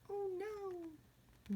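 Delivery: very high little lament; tiny squeaky voice of dismay; mock dismay. Fly caught in web, mock dismay.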